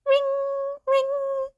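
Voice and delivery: high, even ringing tone; phone ring. Acted.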